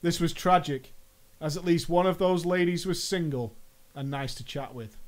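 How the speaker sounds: normal talking voice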